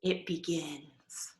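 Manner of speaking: soft, slow ominous drop; ominous hush. Acted. Reader hushing as the change begins. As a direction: let it sink in darkly. Transformation starts.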